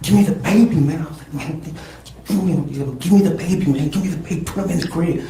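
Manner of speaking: high, loud pleading yell; desperate shouted demand. Retold or imitated. To Selena, begging for the baby, in a desperate high yell. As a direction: shout it in panic.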